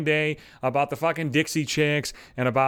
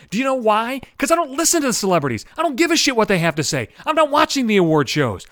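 ordinary talk, nothing marked

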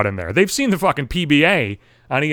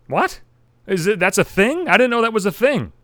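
higher-pitched, incredulous questioning; acted bafflement; 6 semitones higher, 4 dB louder than usual. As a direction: play up being taken aback. Reacting baffled to friend's claim.

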